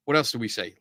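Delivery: normal talking voice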